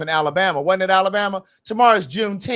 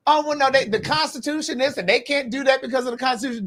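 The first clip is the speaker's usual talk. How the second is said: higher-pitched mocking echo of dismissive friends; mock naysayer quote; 6 semitones higher than usual. Mocking friends who said the Constitution would stop Trump, pitched up. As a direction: parody their excuses, pitched up. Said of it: "they kept saying".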